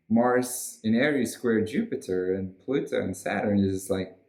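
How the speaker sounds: normal talking voice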